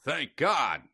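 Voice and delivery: a hint of an old-time inventor giving thanks; lightly voiced Edison in relief; Edison accent. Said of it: "that's my Edison accent".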